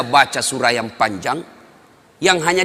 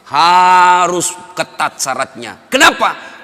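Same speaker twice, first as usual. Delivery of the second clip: louder and higher, hammering each boleh; preaching at full force; 6 semitones higher, 7 dB louder than usual. Acted. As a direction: rise into a fiery sermon climax.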